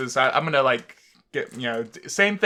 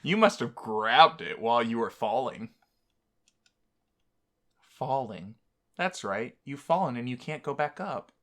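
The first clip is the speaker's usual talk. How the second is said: story narration. A narrator's reading. Narrates game text aloud.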